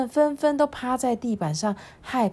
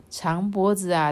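usual speaking voice

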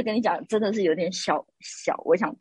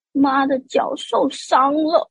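slow, higher whimper of self-pity, imitated; pitiful mother; 7 semitones higher, much slower than usual. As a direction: drag it out like a wounded princess. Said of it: "他妈妈就说". (模仿婆婆慢慢委屈地说)

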